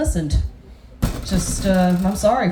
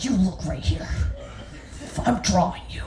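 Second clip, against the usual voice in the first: hushed, low scolding whisper; quiet, soft stern artist; 4 semitones lower, 6 dB quieter than usual. Retold or imitated. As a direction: whisper a sharp order at the model. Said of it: "the artist was like".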